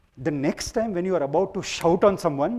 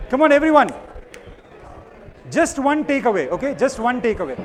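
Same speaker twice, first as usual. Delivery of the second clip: raised, high voice over the noise; calling out to crowd; 6 semitones higher, 7 dB louder than usual. Real, not acted. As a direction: shout instructions across the room.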